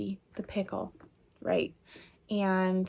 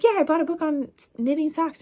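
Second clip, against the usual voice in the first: bright high excited quote, quicker; 9 semitones higher, 5 dB louder than usual. Retold or imitated. Telling the kids about her sock book, excited and high. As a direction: tell the kids eagerly, pitched way up.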